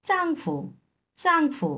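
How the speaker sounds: চাংফু said high, falling চাং and quick light ফু; Mandarin tones. Shown as a demonstration. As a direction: pitch up, চাংফু with neutral tone ফু. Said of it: "এই ফুটা neutral tone".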